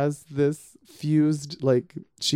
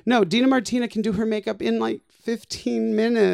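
exasperated; 8 semitones higher than usual. Retold or imitated. Envious rant about friend.

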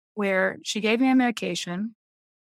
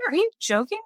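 retold disbelief; 7 semitones higher than usual. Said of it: "And I was like".

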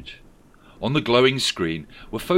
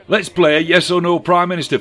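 high, loud, fast brash voice; brash Angela Rayner; 9 semitones higher, 11 dB louder than usual. A narrator's reading. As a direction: blurt it out brashly.

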